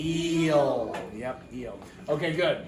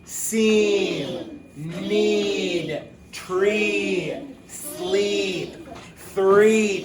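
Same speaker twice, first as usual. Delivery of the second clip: each word called out louder and higher; choral reading lead; 4 semitones higher, 5 dB louder, much slower than usual. Shown as a demonstration. Teacher leads students reading along, calling words out. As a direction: lead the class word by word, bright and carrying. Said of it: "now you guys with me".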